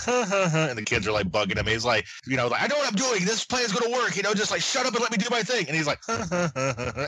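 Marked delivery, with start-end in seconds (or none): 0.0-0.7 breathy mock huh huh chuckle; imitated chuckle. Kai chuckling over his device, mock smug chuckle. 2.6-5.7 high mock yell at the kids, faster at the end; mock snapping. Kai snapping at the kids bugging him, high mock yell. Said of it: "after he yells at them". 6.1-7.1 breathy mock huh huh chuckle; imitated chuckle. Kai chuckling over his device, mock smug chuckle. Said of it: "after he yells at them".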